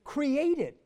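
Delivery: "Created" high and loud; key word proclaimed. Acted. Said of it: "here's the word".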